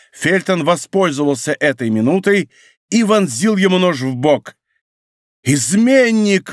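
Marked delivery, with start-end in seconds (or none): 5.4-6.5 high, drawn-out cry of horror; stretched, raised-pitch accusation; horrified cry. A narrator's reading. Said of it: "в ужасе вскричал Бекингем".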